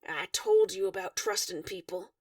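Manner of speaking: ordinary delivery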